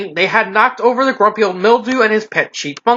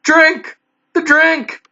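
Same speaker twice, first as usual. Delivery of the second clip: panicked; 7 semitones higher, much slower than usual. Acted. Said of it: "in more panic".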